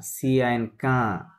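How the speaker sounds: সি আই এন spelled slowly, then কা as one nasal syllable; slow spelling resolving into nasal কা; letters joined as sound. Shown as a demonstration. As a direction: spell it out slowly and land on a long কা. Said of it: "একসাথে হবে না".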